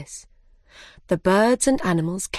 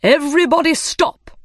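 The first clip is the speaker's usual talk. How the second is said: high, loud bird call; dodo shout; 8 semitones higher, 6 dB louder than usual. A narrator's reading. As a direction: shout to halt the race. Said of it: "the dodo cried".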